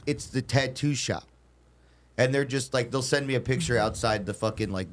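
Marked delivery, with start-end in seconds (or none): 0.1-1.2 drawn out, deliberate words; slow careful directions. Retold or imitated. Customer repeating the shop to a lost driver, slow and deliberate. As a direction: say it slowly for someone not following.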